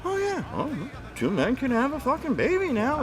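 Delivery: high-pitched credulous imitation; mock gullible believer. People swallowing a repeated claim, mock gullible. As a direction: put on a high, easily convinced voice. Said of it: "some people are like".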